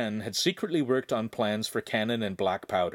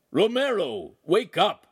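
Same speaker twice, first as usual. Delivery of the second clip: loud call, louder on up; shouted wake-up; 10 semitones higher, 5 dB louder, slower than usual. A narrator's reading. Master shouts up to the loft to wake Romero. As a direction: shout him awake from below. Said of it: "the master's voice shouted".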